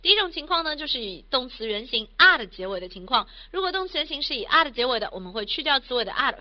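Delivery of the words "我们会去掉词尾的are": ordinary delivery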